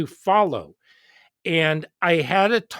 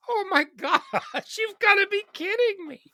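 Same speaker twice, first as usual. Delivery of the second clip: disbelief. Retold or imitated. Teacher's disbelief at parent.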